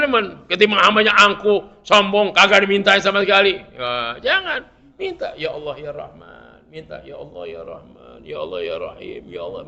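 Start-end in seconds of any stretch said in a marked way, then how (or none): 5.4-9.6 soft, low imploring murmur; pleading to God. Shown as a demonstration. Preacher showing how to beg Allah, soft low pleading. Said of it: "yang merengek".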